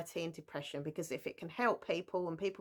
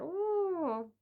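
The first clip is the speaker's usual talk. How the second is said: high, disappointed "Ooh"; 11 semitones higher than usual. Retold or imitated. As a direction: let the "Ooh" sag, let down. Book went downhill.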